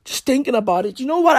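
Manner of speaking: normal talking voice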